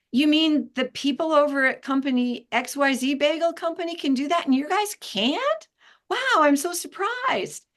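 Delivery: high, hammed-up surprise, rising to the end; needling sarcasm played as wonder; sarcastic mock surprise. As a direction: fake astonishment to shame the client.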